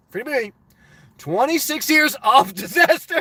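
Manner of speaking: usual speaking voice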